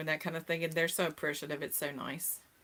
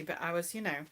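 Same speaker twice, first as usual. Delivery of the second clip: usual speaking voice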